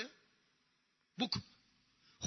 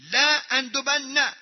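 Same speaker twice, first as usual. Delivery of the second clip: لأندبن drawn out slowly and raised; emphatic stress; 3 semitones higher, 3 dB louder, much slower than usual. Shown as a demonstration. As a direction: stretch لأندبن out with firm emphasis. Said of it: "تأکید، تأکید، حتماً، حتماً". (لأندبن با تأکید و کشیده)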